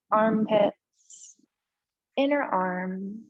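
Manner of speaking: unhurried yoga relaxation voice with long pauses; slow-paced, settled breathing guidance; guided meditation. Real, not acted. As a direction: ease them gently into stillness, leaving space between phrases. Leads restorative pose and breathing.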